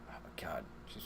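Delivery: breathy whispered aside; hushed under the breath. Real, not acted. Whispered aside about actress.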